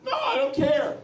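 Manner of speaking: high, laughing, dismissive repeat; laughing impression of an unbothered president; mock Putin shrug. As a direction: brush it off way up high, chuckling. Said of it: "he said".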